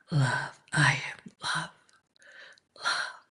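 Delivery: a soft mantra whispered over and over with long pauses; hushed repeated mantra. Acted. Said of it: "let this be your mantra".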